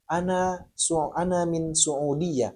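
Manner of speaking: normal talking voice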